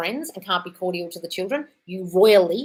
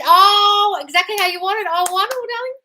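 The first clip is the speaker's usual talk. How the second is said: delighted squeal; 13 semitones higher, 8 dB louder than usual. Real, not acted. Delighted reaction to viewer.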